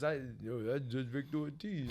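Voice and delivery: quiet, lightly voiced movie-boxer impression; Sylvester Stallone. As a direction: softly imitate a famous action star. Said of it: "Make sure you do his voice".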